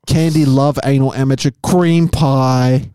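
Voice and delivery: slow, hammed-up title read; deliberate, stretched delivery with a big finish; in-character title reading. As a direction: draw it out like a performance. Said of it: "the tone you think they, they meant it".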